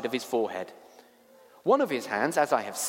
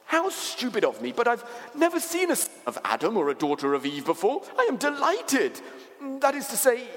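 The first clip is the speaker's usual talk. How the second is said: high, excitable faun voice; fussy creature pitched high; flustered, delighted faun; 10 semitones higher than usual. Acted. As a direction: gush with rising delight, then check yourself.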